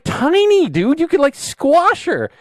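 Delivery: high, drawn-out mock disbelief; imitated high-pitched astonishment; incredulous exclamation. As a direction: squeal it in exaggerated amazement, stretching the key words. Retelling her astonished exclamation.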